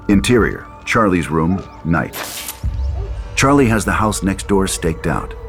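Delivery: ordinary talk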